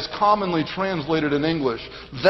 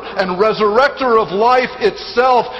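raised, forceful sermon delivery; emphatic preaching; 4 semitones higher, 4 dB louder, slower than usual. Real, not acted. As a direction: proclaim it with weighty emphasis. Preacher builds to conclusion.

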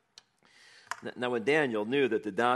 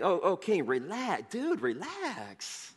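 casual dude; 7 semitones higher, 4 dB quieter, slower than usual. Pretend. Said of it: "No, he didn't say".

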